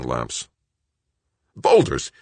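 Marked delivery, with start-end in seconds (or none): 1.6-2.1 high loud alarmed yelp. A narrator's reading. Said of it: "he yelped".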